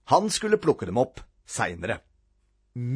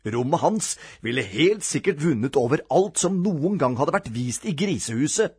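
usual speaking voice

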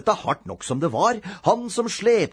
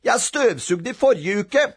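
put-on higher, protesting child; complaining; 6 semitones higher, 3 dB louder than usual. A narrator's reading. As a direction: argue back indignantly. Boy keeps complaining to mother.

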